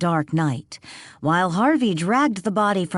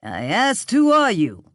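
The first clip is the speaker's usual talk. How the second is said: demanding; 4 semitones higher than usual. A narrator's reading. Harvey demands the stranger's name, rising to an angry push. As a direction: force the answer out of him. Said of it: "Harvey demanded".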